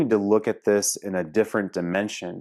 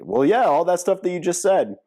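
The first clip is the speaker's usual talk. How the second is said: mocking the client; 9 semitones higher, 3 dB louder than usual. Client's answer acted out.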